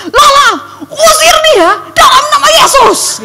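loud, high, slow shout with a laugh in it; rally her to cast it out; urgent command. Retold or imitated. Lecturer urging Lala to cast out the figure, loud high shout. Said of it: "dosen saya bilang gini".

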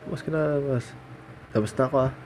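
ordinary talk, nothing marked

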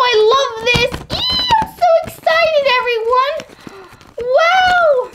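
high squealing delight; excited. Acted. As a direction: squeal like you just got the best gift.

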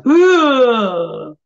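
loud, high shocked groan of disgust; a loud appalled ugh; shocked gasp. Retold or imitated. Attendee behind her, loudly shocked groan. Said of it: "I heard this sound was like".

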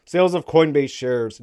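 ordinary talk, nothing marked

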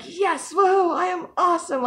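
high, loud whooping shout; a hammed-up victory whoop; triumphant cheer. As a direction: cheer yourself on at full volume. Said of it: "I finally did something and I'm like".

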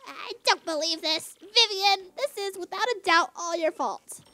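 loud, theatrical blame; scolding villain boss. Acted. Villain boss blaming Vivian, loud theatrical scolding. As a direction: lay into the underling, promising punishment.

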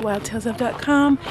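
usual speaking voice

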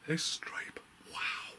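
breathless hushed wow; barely voiced amazement; awed whisper. Real, not acted. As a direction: whisper it in awe. Awed whisper while filming room.

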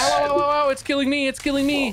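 high, rapid yelps of game panic; panicked yelping. Pretend. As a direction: yelp for help as it attacks you. Game character attacked by fox.

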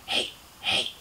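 soft unvoiced leering whisper; whispered imitation of a leering stranger; whispered catcall. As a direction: hiss it creepily through the glass. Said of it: "like that".

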